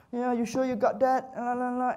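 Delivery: high-pitched mock client pestering; nagging client. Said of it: "They're gonna literally go".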